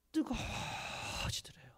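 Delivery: 뜨거워지더래요 breathed in a slow whisper. Acted. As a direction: let 뜨거워지더래요 come out as a slow secret whisper. Hushed build before the climax.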